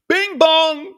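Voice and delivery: high sing-song chime, bing rising; bing bong sound effect. Pretend. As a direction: chime it out like a doorbell. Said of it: "That's what that covid does".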